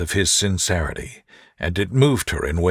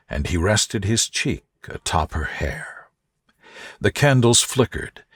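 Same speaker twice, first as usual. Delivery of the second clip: plain reading voice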